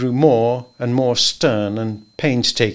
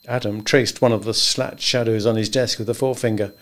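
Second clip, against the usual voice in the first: ordinary talk, nothing marked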